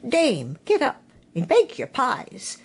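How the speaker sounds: lightly voiced rooster, rising high at the end; a hint of a cock crowing the dawn. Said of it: "the cocks cried out".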